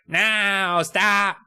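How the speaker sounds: whiny, high, through the nose; high nasally Lauren. Retold or imitated. Lauren objecting to the water guns, high nasally voice. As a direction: put on a high nasal whine. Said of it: "she has a very high nasally voice".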